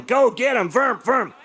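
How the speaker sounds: high-pitched play-fight chant; excited toy-battle voice with mouthed sword noises; boy playing Star Wars. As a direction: mimic a kid cheering on a lightsaber duel. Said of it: "just sees me going".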